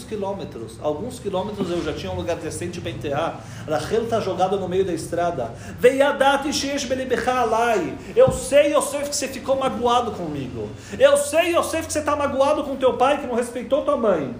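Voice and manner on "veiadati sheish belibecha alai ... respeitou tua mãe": pleading father. Acted. Jacob pleads with Yosef.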